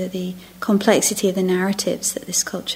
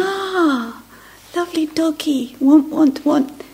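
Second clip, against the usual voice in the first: much higher doting coo, drawn out at the end; cooing to a dog; 6 semitones higher, 3 dB louder, slower than usual. Retold or imitated. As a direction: talk to the doggy like you adore it.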